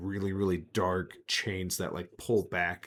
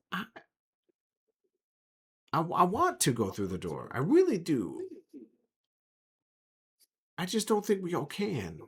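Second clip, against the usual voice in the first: higher, hesitant, pleading voice; nervous companion voice; 9 semitones higher than usual. Acted. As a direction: voice a reluctant companion, pitched up.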